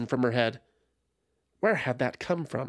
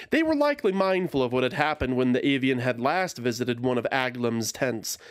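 usual speaking voice

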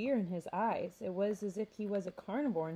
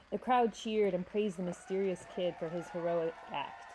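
ordinary delivery

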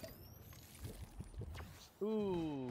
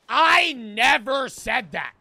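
much louder, slow and firm; loud slow retort; 8 dB louder, slower than usual. Retold or imitated. Interrupting the professor, loud slow mock retort. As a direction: cut him off hard. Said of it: "I don't say it like that".

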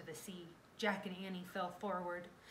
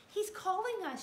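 high, raised voice; urgent shout; 13 semitones higher, 6 dB louder than usual. A narrator's reading. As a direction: shout it as Annie. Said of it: "shouted Annie".

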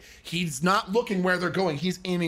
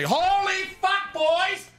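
much higher, hammed-up bellowing sergeant voice; imitating drill sergeant; 14 semitones higher than usual. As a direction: belt it out like a sergeant ordering lights out. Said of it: "he'd come in and say".

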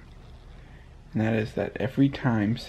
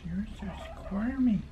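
high soft coo; 8 semitones higher, 5 dB quieter than usual. Real, not acted. Cooing at baby.

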